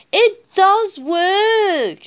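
high, loud, drawn-out exclamation; elated realisation. A narrator's reading. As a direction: stretch it out slowly in triumph. Cat delighted that song works.

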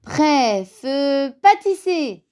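rising, high starter's countdown; exclaiming. A narrator's reading. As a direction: launch the race with glee. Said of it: "s'exclama Petit Ours". (s'exclamer, de plus en plus aigu)

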